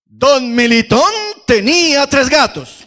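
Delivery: high, slow, booming chant-like shout; thundering voice. Shown as a demonstration. Leader giving the crowd a line to repeat, thundering voice. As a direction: shout it out like a soldier, stretching the words. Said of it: "con voz de trueno".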